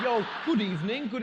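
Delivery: laughing mock British accent for the host's impression; posh British accent. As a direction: put on a prim English accent through a laugh. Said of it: "he was talking like the Queen".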